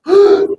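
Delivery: high frightened gasp of a cry; sudden high cry of fear; startled cry. Acted. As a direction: jump at the sight of a ghost. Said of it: "se turbaron diciendo".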